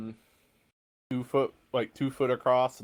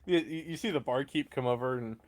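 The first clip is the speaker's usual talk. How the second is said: normal talking voice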